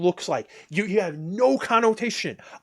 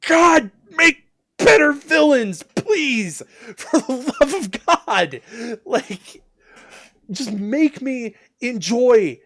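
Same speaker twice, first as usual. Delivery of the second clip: much higher, louder, slow pleading with a laugh; exasperated plea; 6 semitones higher, 5 dB louder, slower than usual. Real, not acted. Fed-up fan begging writers for better villains, high, loud and laughing. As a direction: beg the heavens, half laughing.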